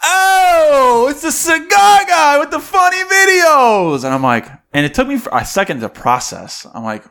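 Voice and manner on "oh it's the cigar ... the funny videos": high, loud, slow shout; calling from across the street. Retold or imitated. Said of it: "I hear a guy from across the street".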